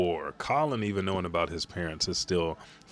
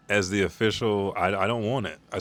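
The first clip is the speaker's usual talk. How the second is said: ordinary talk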